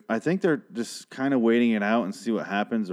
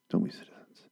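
whisper; 7 dB quieter than usual. Acted. Hushed aside repeated.

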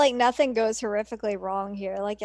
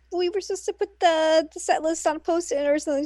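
higher imitation voice; acted character reply; 7 semitones higher, faster than usual. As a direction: quote the girl in a lifted voice. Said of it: "She's like".

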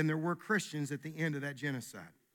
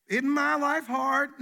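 slow, whiny woe-is-me voice, higher; drawn-out mock lament, pitched up; mock self-pity; 6 semitones higher, 5 dB louder, slower than usual. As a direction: ham up the poor-me victim. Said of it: "This isn't about".

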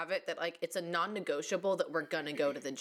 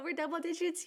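higher, bright celebration; excited; 10 semitones higher than usual. Real, not acted. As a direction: cheer it out. Celebrating chapter ten.

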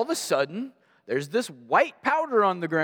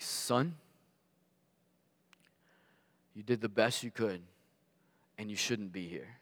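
soft, low voice with long pauses; hushed father; 5 semitones lower, 9 dB quieter than usual. Retold or imitated. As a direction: imitate a dad gently breaking it to his son. Father's grave reassurance, voiced in story.

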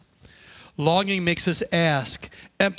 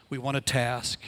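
ordinary delivery

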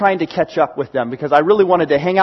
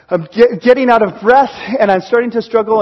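usual speaking voice

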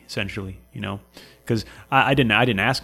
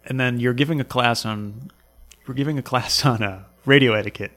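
usual speaking voice

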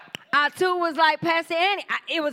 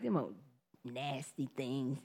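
disgusted mutter; 13 semitones lower, 13 dB quieter, slower than usual. Retold or imitated. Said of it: "but I was like".

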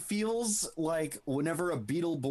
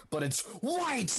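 mock scream; 8 semitones higher than usual. Imitating a director giving pre-direction, mock scream like Hades. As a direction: build to a mock scream on the last word. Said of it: "like, fucking screams it like Hades".

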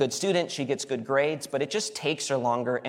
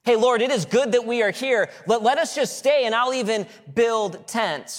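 high and loud, pleading to stay; eager Peter; 8 semitones higher, 5 dB louder than usual. Retold or imitated. Preacher mocking Peter's request, high eager plea. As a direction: cheerfully beg to build tents and stay. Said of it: "when he says".

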